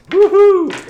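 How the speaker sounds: high, loud whoop; real burst of triumph; excited cheer. Real, not acted. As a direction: whoop with glee at the dice. Celebrates the damage roll.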